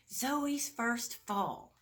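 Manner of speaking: drawn-out proud announcement; slow reveal. Acted. Mom announcing the family celebration, slow and proud. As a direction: savor each word of the surprise.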